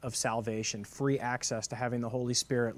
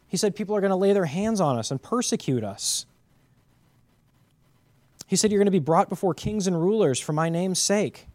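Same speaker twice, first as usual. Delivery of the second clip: loud, higher, pounding preacher cadence; full-force preaching; 6 semitones higher, 6 dB louder than usual. Acted. Pastor preaching Luke to his church, loud and fervent. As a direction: thunder the scripture at the congregation.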